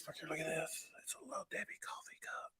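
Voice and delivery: hushed reveal whisper. Acted. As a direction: whisper it like a secret. Showing item in whisper.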